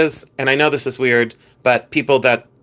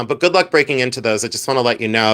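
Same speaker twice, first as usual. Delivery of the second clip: ordinary delivery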